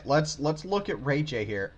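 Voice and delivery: usual speaking voice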